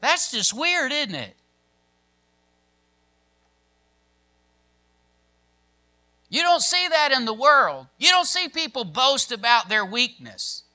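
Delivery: high, incredulous pulpit delivery; emphatic preaching. Real, not acted. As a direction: hammer the repeated phrase to the congregation.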